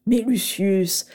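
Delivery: lightly voiced delighted old professor, slow; Slughorn. A narrator's reading. Professor Slughorn to Lucius, delighted, slow and fond. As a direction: savour the name, pleased and unhurried.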